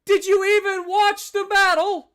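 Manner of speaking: anguished, incredulous shouting at teammates; shouting in disbelief. Real, not acted. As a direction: yell it at the screen like you can't believe it. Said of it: "This is more disbelief".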